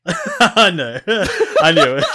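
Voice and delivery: loud, high words through laughter; laughing hard. Real, not acted. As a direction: crack up while admitting it. Laughs at being found out.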